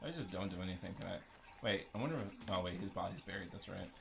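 ordinary delivery

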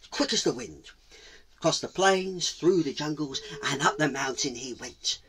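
ordinary delivery, nothing marked

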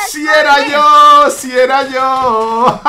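slow, drawn-out cackling taunt; gloating villain confession. Acted. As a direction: confess gleefully with an evil laugh. (villano confesando entre carcajadas, muy lento)

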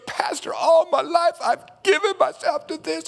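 high, straining shout of a bereaved mother; grieving mother's cry. Retold or imitated. Bereaved mother calling out to the pastor, anguished. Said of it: "she goes".

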